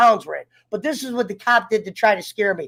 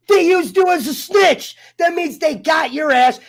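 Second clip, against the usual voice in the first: angry shout; 5 semitones higher than usual. Real, not acted. Venting at the girl who snitched, angry shout. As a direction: blast it at her.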